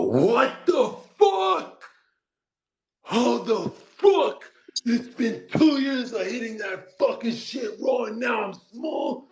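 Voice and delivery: high-pitched indignant yell; loud, high, acted-out shock; outraged disbelief. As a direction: cry out in wounded outrage, drawing out the first words. Acts out his outraged reaction to insult.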